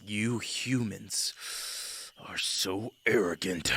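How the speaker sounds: slow lion growl. Acted. Male lion disdaining a human, slow growl. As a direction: drag it out and end in a growl. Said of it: "He kind of growls and he says".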